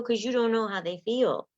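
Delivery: usual speaking voice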